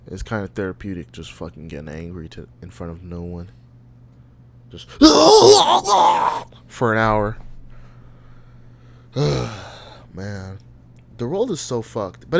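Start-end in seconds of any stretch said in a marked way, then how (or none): 4.9-6.6 loud high laugh. Real, not acted. Laughs at himself.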